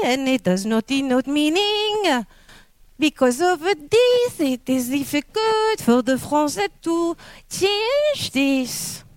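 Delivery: exaggerated French accent, last syllables drawn out. Shown as a demonstration. Lecturer explaining French speakers' difficulty, hammed-up French accent.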